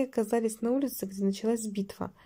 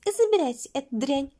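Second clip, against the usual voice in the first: higher-pitched dismissive cry; mild outburst, pitched up; exclamation; 5 semitones higher, 4 dB louder than usual. A narrator's reading. Anastasia hands Lizi over, high exclamation. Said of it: "воскликнула".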